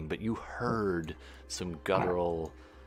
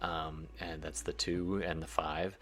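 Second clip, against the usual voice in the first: ordinary talk, nothing marked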